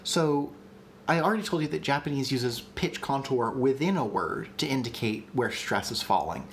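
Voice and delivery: normal talking voice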